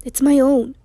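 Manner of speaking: urgent, pleading insistence; desperate. A narrator's reading.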